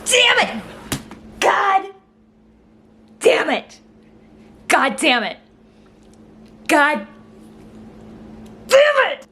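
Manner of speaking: separate, punched curses with falling pitch; exasperated anger. Real, not acted. Reacting to a hated movie line, exasperated anger. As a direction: curse in bursts, building to a high shout. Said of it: "I'm so angry".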